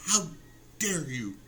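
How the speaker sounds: slow, broken-up mock indignation; Greta Thunberg. Comedian showing his post is satire, drawn-out mock outrage. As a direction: pause heavily after "How" for satirical outrage. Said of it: "that should tell you it's satire".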